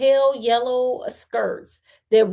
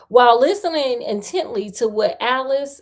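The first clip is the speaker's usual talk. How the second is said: usual speaking voice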